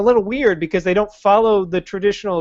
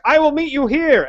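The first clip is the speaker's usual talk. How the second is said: higher, breezy promise; lightly voiced wizard making an empty promise; mock Gandalf; 9 semitones higher than usual.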